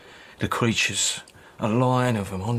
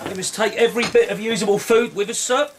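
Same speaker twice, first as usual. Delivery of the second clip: brisk rallying call; 9 semitones higher, 5 dB louder than usual. Acted. Soldier rallying the curate.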